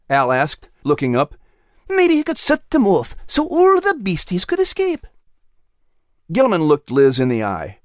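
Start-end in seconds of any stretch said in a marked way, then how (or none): 1.9-5.1 high, curious small creature voice; a small pet asking eagerly; little animal character. Acted. Al continues.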